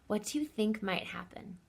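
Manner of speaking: hushed secret question; whispering. Real, not acted. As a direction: whisper it to the class. Teacher whispers question to kids.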